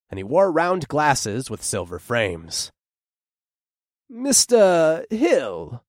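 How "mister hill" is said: slow, formal greeting; formal lawyer. A narrator's reading. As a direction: greet him stiffly like a lawyer.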